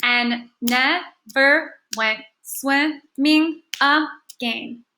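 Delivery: very slow, each syllable set apart; deliberate syllable-by-syllable reading; clapped-out syllables. Shown as a demonstration. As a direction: clap out every syllable as you read. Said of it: "clap and count the syllables that we say".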